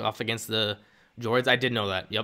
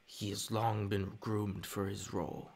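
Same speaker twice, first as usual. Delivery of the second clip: low, slow, hushed Sith voice; quiet villain line; 3 semitones lower, 11 dB quieter, slower than usual. Pretend. Streamer imitating a Sith master, slow and hushed. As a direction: purr it like a scheming master. Doing Palpatine-style line.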